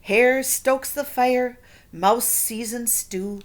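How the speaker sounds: put-on lively read-aloud to children; storybook reading. A narrator's reading. Teacher reading a picture book to her class. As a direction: read it like story time for kids.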